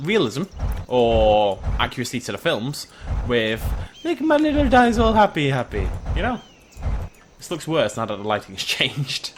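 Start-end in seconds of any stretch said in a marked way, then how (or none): 3.3-6.4 put-on high cooing baby voice on my little dinosaur; cutesy baby talk. Wanting happy dinosaurs, high cutesy voice. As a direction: coo it like talking to a pet.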